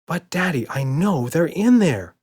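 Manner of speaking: put-on little girl yelling. A narrator's reading. Emma insists to Daddy that monsters are there, childish yell. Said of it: "yelled Emma".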